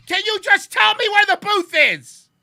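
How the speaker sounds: very high, loud fed-up plea; squeaky loud frustrated question; exasperated plea. Retold or imitated. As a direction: beg in total exasperation.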